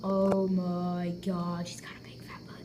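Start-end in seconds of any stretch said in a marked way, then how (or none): 1.7-2.6 whispering. Real, not acted. Teasing aside about the dog.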